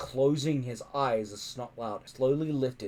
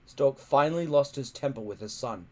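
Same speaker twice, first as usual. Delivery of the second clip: ordinary delivery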